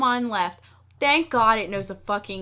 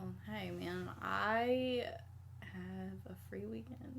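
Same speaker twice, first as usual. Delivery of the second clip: soft conspiratorial whisper; sneaking a jab at her cohost in a whisper; 2 semitones lower, 15 dB quieter, slower than usual. Real, not acted. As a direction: whisper it under your breath, teasing. Teasing aside while cohost talks.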